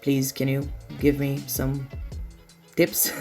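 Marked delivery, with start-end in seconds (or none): none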